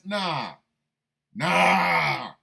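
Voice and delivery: loud, drawn-out refusal; big comic yell of rejection; emphatic refusal. Real, not acted. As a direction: belt out the refusal. Emphatic refusal after rhetorical question.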